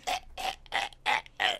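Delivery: breathless croaking gasps, no voice in them; dying croaks. Retold or imitated. Tied-up cartoon man gasping as he dies, voiceless croaks. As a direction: imitate a tied-up cartoon villain choking out his last breaths. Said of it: "you know, they're dying".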